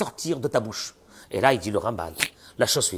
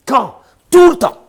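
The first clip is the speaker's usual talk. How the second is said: much higher, much louder burst; emphatic shout; 11 semitones higher, 15 dB louder, slower than usual. Acted. Rabbi to parents, shouting that it means always. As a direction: shout the answer to drive it home. Rabbi drives home the point.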